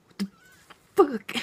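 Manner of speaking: exasperated outburst. Retold or imitated. Package stuck a week.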